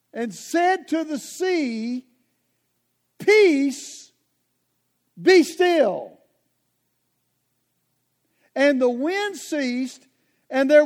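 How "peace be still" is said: loud commanding "Peace, be still"; commanding rebuke. Acted. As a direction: rebuke the storm with full authority. Jesus rebuking the storm.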